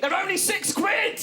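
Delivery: high-pitched, drawn-out comic exasperation; exasperated outburst. Acted. Comedian ranting at concert phone-filmers, exasperated. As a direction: cry it out high and slow, incredulous.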